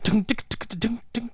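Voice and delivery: hummed dun-dun music beat; imitating background music. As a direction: mouth the soundtrack rhythm. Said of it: "music like this playing".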